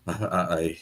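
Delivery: normal talking voice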